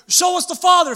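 high, loud, urgent plea; Philip. Acted. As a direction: call out the demand at full voice. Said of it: "Philip says".

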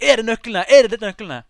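high, loud insistent question; re-enacted shout. Fan with the microphone asks about the keys, calling loudly. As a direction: call it out, pushing harder the second time. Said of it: "jeg roper da inn i mikrofonen".

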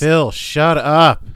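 loud, slow command. Acted. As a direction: drag it out, loud. Telling off friend on call.

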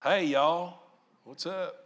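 lightly put-on Southern drawl greeting; a hint of a Southern drawl, said casually; mild Southern accent. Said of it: "You got all drawly Southern on 'em".

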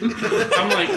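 normal talking voice

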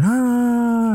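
high, sharp mock scream. Damien screaming after Mark dies, a high mock shriek. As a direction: let out a sudden shriek. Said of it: "Damien starts screaming".

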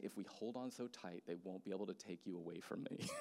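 soft retold child's plea; hushed, earnest goodbye plea; tender pleading. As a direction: imitate a clinging friend, very softly. Retold friend clinging at final goodbye.

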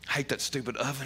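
whispered through a laugh. Real, not acted. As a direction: breathe it out, laughing under your breath. Laughing aside about the oven.

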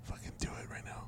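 fast, urgent whisper; frantic whisper. Acted. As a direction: hiss it out in a hurry. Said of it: "Why are you whispering?".

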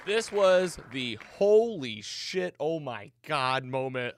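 real excitement pouring out; awestruck excitement. Real, not acted. Recalling shocking reveal, overwhelmed.

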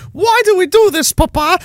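put-on high, childlike voice of a small son; little son. Little son asking his dad a question, high childlike voice. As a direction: pipe up like a curious little boy. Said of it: "my five-year-old son asked me".

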